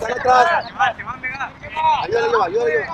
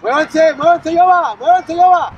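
shouting; 4 semitones higher, 6 dB louder than usual. Real, not acted. Coach yelling from the sideline.

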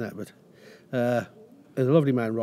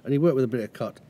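ordinary talk, nothing marked

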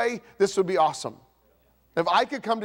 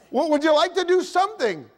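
higher, louder pleading wife; wife losing patience with a sulking husband, high and louder; exasperated wife; 9 semitones higher, 4 dB louder than usual. Retold or imitated. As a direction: plead with rising patience running out. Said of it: "your wife says".

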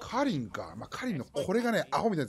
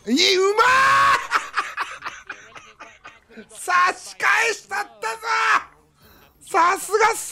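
shrieking with excitement; high excited shout; hit hard crying out; 6 dB louder, much slower than usual. Real, not acted. Big play cheered, shouting.

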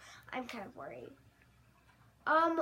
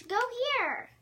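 yelling across the room; 6 semitones higher, 6 dB louder than usual. Acted. Ordering sim to come.

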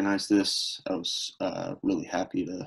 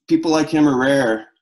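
emphatic; 5 semitones higher, 8 dB louder than usual. Real, not acted. Eulogy for lost friend.

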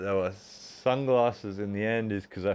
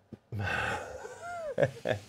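sudden squeaky laugh, much higher; high breathless laugh; 7 dB quieter than usual. Real, not acted. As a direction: let out a high, airy giggle. Laughs after finishing reps.

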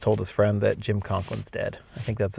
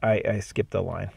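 ordinary delivery

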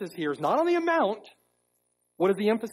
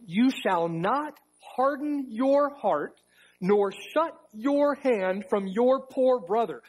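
solemn scripture reading; 6 semitones higher than usual. Acted. Reads Deuteronomy aloud.

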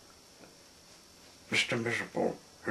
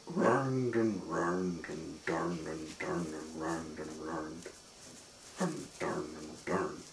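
slow, lower and fading away; descending narration; 5 semitones lower, 4 dB quieter than usual. A narrator's reading. Narrator follows stairs round and down, slow sinking voice. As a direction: let the voice wind slowly downwards.

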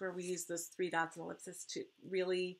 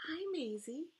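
usual speaking voice

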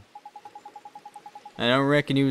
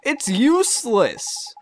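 slow, higher complaint; exasperated; 6 semitones higher, much slower than usual. Acted. Drippy complains the pot is useless, slow exasperation. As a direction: drag out the grievance.

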